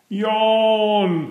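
high, stretched sleepy yawn word; sleepy engine waking up; drawn-out yawn. A narrator's reading. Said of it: "said Thomas, getting started at last".

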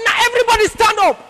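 high, quick preacher's order; mock pastor ordering the church; pastor's command. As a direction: boom it out like a pastor. Pastor voiced in church story.

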